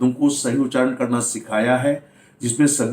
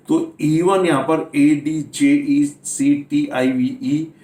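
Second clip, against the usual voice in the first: normal talking voice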